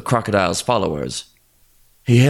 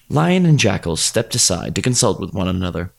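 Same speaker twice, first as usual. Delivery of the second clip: usual speaking voice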